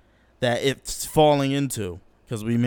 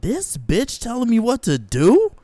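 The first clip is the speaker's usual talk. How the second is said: put-on indignant kid; 9 semitones higher, 5 dB louder than usual. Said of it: "then I was like".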